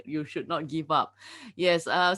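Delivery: ordinary talk, nothing marked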